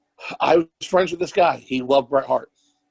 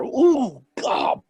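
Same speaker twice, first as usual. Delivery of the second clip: mock swooning; 9 semitones higher than usual. Mocking Vince ogling Orton.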